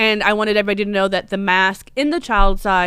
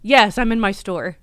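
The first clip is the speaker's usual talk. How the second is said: ordinary talk, nothing marked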